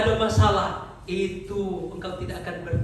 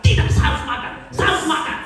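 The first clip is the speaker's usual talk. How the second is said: loud, raised, resolute cry; determined inner voice; 6 semitones higher, 9 dB louder than usual. Acted. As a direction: push back firmly, loud and high. Suara hatimu harus berkata.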